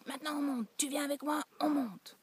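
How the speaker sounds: mock scold the child up the stairs; angry order. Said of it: "je me fâche et je crie".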